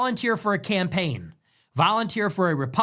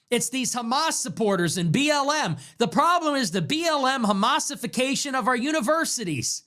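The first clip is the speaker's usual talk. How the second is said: higher mocking imitation of a pundit's talking points; imitating Joe Lonsdale; 6 semitones higher than usual. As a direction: parody a polished tech pundit, voice pitched up. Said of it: "You know what Joe Lonsdale says? He says".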